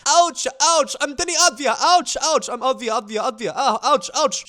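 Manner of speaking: hammed-up whiny ouch voice; mock flopping player. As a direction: ham up a player flopping for fouls. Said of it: "he goes".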